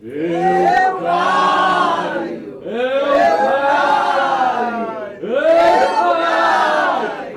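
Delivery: loud, high, sung ghostly wail; a chorus of ghosts booming. Acted. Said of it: "aquela voz veio em coro". (coro fantasmagórico alto)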